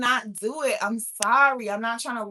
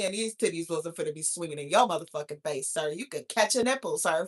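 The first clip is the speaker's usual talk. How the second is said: normal talking voice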